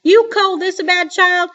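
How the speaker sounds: put-on high, loud booming old woman; shouting grandma. A narrator's reading. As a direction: shout it down the phone line. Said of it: "while she shouted".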